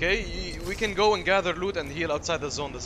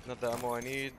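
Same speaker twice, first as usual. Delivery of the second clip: low ammo mutter; 5 semitones lower, 9 dB quieter than usual. Real, not acted. Wrong ammo pickup.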